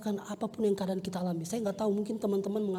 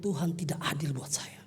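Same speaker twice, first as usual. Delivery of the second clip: normal talking voice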